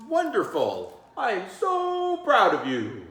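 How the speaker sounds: teacher bursting with pride; delighted praise. Acted. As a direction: gush over the kids' skit.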